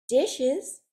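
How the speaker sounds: normal talking voice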